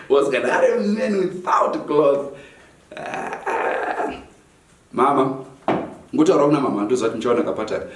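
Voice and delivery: ordinary talk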